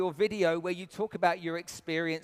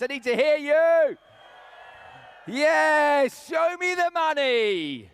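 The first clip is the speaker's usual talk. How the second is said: high, loud prize hype shout; booming prize announcer; 7 semitones higher, 5 dB louder, much slower than usual. Real, not acted. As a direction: sell the cash prize big. Host hypes the cash prize.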